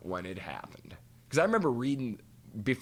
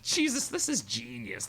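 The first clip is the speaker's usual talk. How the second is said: amazed thought; 13 semitones higher than usual. Retold or imitated. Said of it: "I thought".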